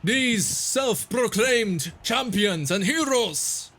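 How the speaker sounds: high, slow booming arena announcer. Acted. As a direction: boom it out over the crowd. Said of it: "you hear a booming voice kind of pierce through the crowd".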